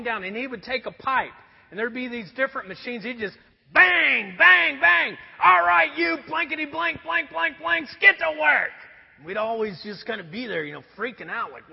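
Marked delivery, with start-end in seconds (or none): 3.0-5.2 loud imitated bangs of a pipe; mock banging. Preacher loudly imitating the foreman banging machines. 5.4-8.7 loud, higher gruff boss shout; foreman's yell. Retold or imitated. Preacher voicing the foreman yelling at the crew to work.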